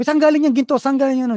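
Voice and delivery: high, loud angry demand; mock furious wife. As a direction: confront him about the gold. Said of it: "galit na galit yung asawa niya".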